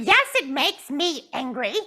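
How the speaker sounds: pouting puppet. Acted. Puppet Jasmine tells the counselor she is mad, pouting high. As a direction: stretch out the sulk.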